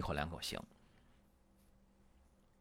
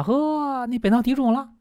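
much higher, louder, alarmed doctor; exclaiming doctor voice, pitched way up; mock fussing doctor; 10 semitones higher, 6 dB louder than usual. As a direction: ham up a doctor fussing over the throat. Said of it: "一复查".

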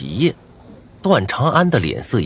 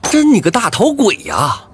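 furious retort; 11 semitones higher than usual. Acted. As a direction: snap back in angry disbelief. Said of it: "我怒极反笑".

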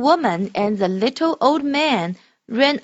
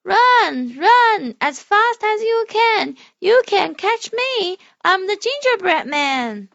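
high, slow taunting cookie voice; teasing gingerbread man; 9 semitones higher, 4 dB louder, slower than usual. Acted. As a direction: taunt them in a squeaky little voice. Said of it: "He laughed and l-, said".

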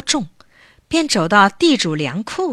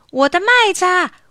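high-pitched storybook cry after the runaway jar; high, urgent acted call; calling out in alarm; 10 semitones higher, 4 dB louder than usual. A narrator's reading. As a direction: cry out while giving chase. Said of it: "一边追一边喊".

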